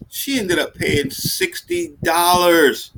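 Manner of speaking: ordinary talk, nothing marked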